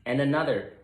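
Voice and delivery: ordinary delivery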